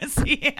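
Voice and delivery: rapid, machine-gun burst of laughter; jammed AK-47 laugh. Shown as a demonstration. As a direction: show off the stuttering rat-a-tat laugh. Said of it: "laugh like a jammed A K forty-seven".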